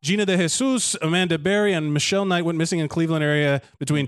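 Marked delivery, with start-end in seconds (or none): none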